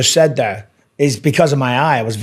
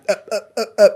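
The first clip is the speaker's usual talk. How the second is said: mock stammering freeze; 8 semitones higher, much faster than usual. A fighter mocking his own first studio freeze with a stammer. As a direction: play the frozen rookie who can't find words. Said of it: "they're like, talk, and you're like".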